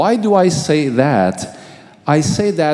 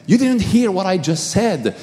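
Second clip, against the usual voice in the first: desperate pleading; 8 semitones higher than usual. Retold or imitated. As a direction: beg him to keep away, in distress. Begging Jesus not to come.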